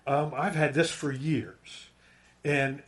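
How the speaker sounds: normal talking voice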